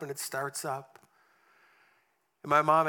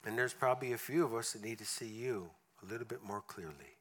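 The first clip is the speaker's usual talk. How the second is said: prayer; 5 semitones lower, 5 dB quieter than usual. Acted. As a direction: pray quietly over the congregation. Closing prayer.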